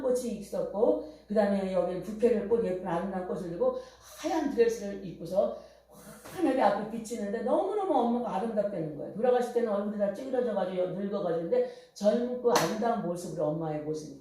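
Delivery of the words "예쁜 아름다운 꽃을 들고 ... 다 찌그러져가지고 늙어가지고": ordinary delivery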